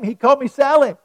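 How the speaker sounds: put-on high, loud excited child calling Mommy; excited little girl. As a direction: squeal it like a thrilled little girl. Child voiced in story, excited.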